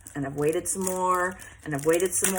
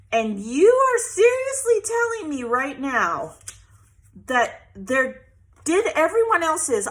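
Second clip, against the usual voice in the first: loud, indignant protest rising higher; worked-up, affronted complaint; angry retold rant; 4 semitones higher, 5 dB louder than usual. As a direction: relive the outrage, hitting it hard. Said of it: "yeah, I was angry".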